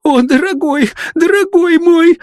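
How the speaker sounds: cling to the beloved in tearful despair; sobbing plea. A narrator's reading. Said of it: "рыдала несчастная девица".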